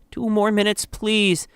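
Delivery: high, pleading mother; begging. A narrator's reading. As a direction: beg for a little longer. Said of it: "You beg".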